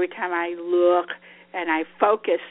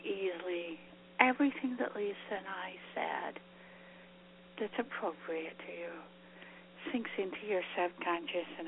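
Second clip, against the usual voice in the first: guided meditation; 5 dB quieter than usual. Acted. Leads a closing breathing meditation.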